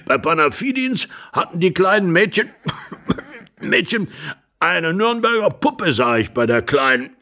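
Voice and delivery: put-on high old man's voice, broken by coughing; rambling old husband. Old husband tells of the girls' doll, coughing. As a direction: keep rambling between coughs.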